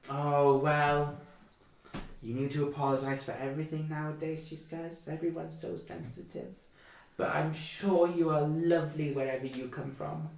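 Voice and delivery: put-on high, kindly old mother's voice; a hint of a chatty elderly mother; old woman. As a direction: fuss over the guests like a doting granny.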